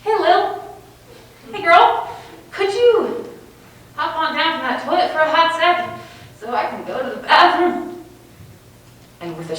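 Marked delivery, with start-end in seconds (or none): 0.0-8.0 high, loud, coaxing plea; sweet pleading. Retold or imitated. Girl coaxing her friend off the toilet, high and wheedling. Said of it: "So I'm thinking".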